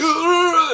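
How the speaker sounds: loud, high, drawn-out "ugh"; imitated brain-overload groan; rising panic noise. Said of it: "it's just like".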